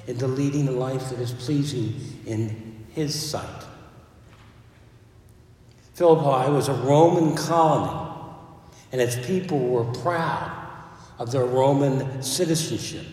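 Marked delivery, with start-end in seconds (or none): 5.9-13.0 loud, ringing pulpit delivery; louder sermon voice rising to the Lord Jesus Christ; full-force preaching. Acted. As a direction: proclaim it to the back pew.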